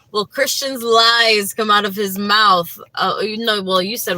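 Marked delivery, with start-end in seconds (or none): 0.1-2.8 loud, slow, laughing through the misread question; giggling at her own slip; laughing while reading. Real, not acted. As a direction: read it out cracking up.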